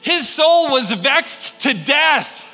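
slow, high, loud declaration; dramatic emphasis. Acted. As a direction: draw it out with weight. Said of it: "She pressed him hard".

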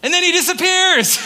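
very high, loud comic exclamation; incredulous punchline. Real, not acted. Preacher telling congregation of Jesus vanishing, loudly amazed. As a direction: burst out with the absurd punchline.

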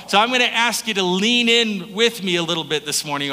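ordinary talk

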